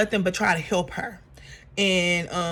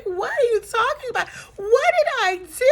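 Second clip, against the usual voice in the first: high, whiny play-innocent voice; mock innocence; 13 semitones higher than usual. Drew protesting innocence to Porsha, in a high mock-whiny voice. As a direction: ham up a wide-eyed denial. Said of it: "Drew was like".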